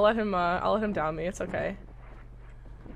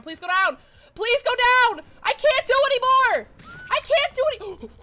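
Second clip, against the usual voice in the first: building from pleading to higher, louder begging; desperate pleading; 8 semitones higher, 4 dB louder than usual. Real, not acted. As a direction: beg harder and louder each time. Pleading grows into desperate yelling.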